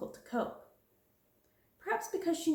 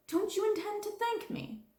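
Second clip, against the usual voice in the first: much higher, affronted question; indignant demand; 13 semitones higher than usual. Acted. Affronted demand. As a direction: headmistress demanding gratitude, affronted.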